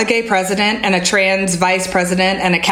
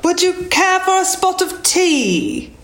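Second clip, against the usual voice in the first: high, slow put-on British accent; 8 semitones higher, slower than usual. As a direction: stretch out a mock-British tea invitation. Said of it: "how to say in a British accent".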